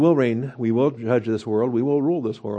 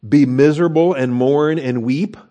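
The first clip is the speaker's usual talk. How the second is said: forceful command; 3 semitones higher, 7 dB louder than usual. Acted. Preacher reads the command forcefully.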